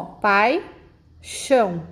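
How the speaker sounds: "pai, chão" as two separate beats; split into syllables. Shown as a demonstration. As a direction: pause after pai, then drop onto chão. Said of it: "quando fazemos a separação".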